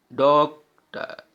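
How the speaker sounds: Doctor slowly split into two beats; syllable by syllable. Shown as a demonstration. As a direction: pause inside doctor before टर. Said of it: "टू बार effort करना पड़ रहा है". (Doctor को तोड़कर, धीरे)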